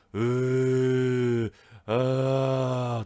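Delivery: loud, drawn-out imitated growl. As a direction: groan it out like the eerie beast on the video. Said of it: "うなり声が". (不気味なうなり声の真似、大きく長く)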